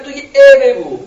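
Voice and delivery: the brain pushing you to stall; mock voice of the brain; brain talking. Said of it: "مغز میگه".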